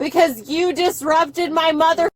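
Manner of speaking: ordinary delivery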